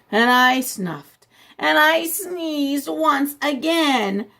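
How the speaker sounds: slow, drawn-out and rising; dragged-out sneeze build. Acted. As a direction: stretch it out toward the sneeze. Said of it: "felt my cold coming on".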